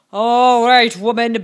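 loud giant's bellow. A narrator's reading. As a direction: bellow it at the woman of the house. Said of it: "bellowed the giant at the door".

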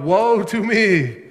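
loud prophet's cry, imitated. Preacher voicing Isaiah before God's glory, crying out. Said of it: "he cries out".

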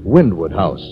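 ordinary talk, nothing marked

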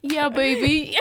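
put-on high, laughing baby voice; cutesy baby voice. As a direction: coo it like a toddler. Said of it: "do your baby impression again".

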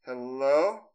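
drawn-out rising hello; eerie distant caller. Retold or imitated. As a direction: imitate a mysterious voice calling out. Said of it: "sounded like somebody saying".

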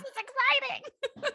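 very high, drawn-out mock squeak; suppressed excitement. Imitating Trish trying to be supportive, squeaky excitement. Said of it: "trying to be supportive, but also, like".

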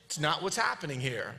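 ordinary talk, nothing marked

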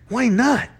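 high-pitched indignant question; getting upset. A narrator's reading. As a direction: demand a reason, pitch jumping up. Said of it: "Quentin was getting upset".

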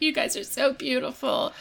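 high, soft tearful gush; teary Topanga. Retold or imitated. Bride tells bridesmaids they look beautiful, softly gushing. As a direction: gush through happy tears. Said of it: "she's like".

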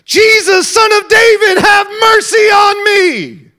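high, loud, slow pleading shout. Acted. Preacher voicing the blind man's plea, loud slow shout. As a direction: cry out at full voice for mercy. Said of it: "You need his help".

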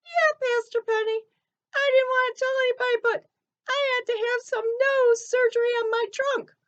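high, squeaky cartoon elephant. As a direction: chirp it like a little elephant puppet. Ellie explains her nose surgery.

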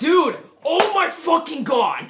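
high, very loud disbelief; astonished outburst. Real, not acted. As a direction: shout it, drawn out slowly in amazement. Astonished reaction to Illuminati story.

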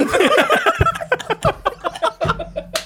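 long high-pitched laughing fit; high laughter. Real, not acted. Friends hear of the bum rush, high helpless laughter. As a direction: crack up in a high squeal of laughter.

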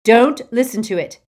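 loud, harsh command. Acted. Harry orders Ron to ignore the locket, harsh and loud. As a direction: cut through the voice sharply. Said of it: "Harry said harshly".

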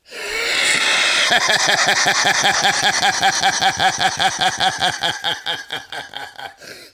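high, wild cackling laugh impression; Kevin McCreary laugh. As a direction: cackle high and loud like a friend. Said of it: "let me do my Kevin McCreary impersonation".

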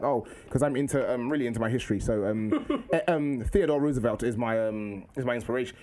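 put-on low, proper kid voice with hesitations; formal schoolboy impression. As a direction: imitate a well-spoken, serious pupil. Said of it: "How comes you talk like this?".

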